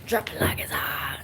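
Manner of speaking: low whispered lyric; whispering. Pretend. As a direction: breathe the lyric under your breath. Whispered sing-song line.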